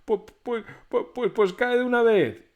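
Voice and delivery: high, loud stuttering; terrified stammer. A narrator's reading. Terrified Pedro stammers at the ghost, loud and high. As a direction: force the words out through fear. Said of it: "muerto de miedo".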